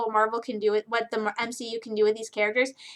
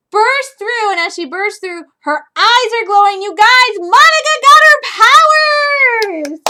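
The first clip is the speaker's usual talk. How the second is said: high, loud building excitement; excited; 9 semitones higher, 11 dB louder than usual. Real, not acted. Excited about Monica's powers.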